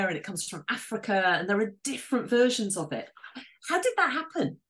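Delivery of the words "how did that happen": high-pitched, marvelling question; excited amazement, pitch lifted well up; real excitement. Real, not acted. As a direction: wonder at it, genuinely thrilled. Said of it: "I just get so excited by that".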